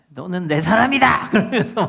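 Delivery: loud mock-drama outburst; imitated angry declaration. Preacher imitates Park Shin-yang in a drama, angry outburst. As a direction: act out an actor's angry confession. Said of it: "이러면서 막 화를 내잖아요".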